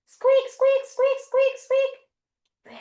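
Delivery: very high, quick mouse squeaks; little mouse. Pretend. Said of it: "can everybody come and make the mouse?".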